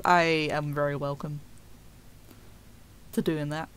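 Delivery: normal talking voice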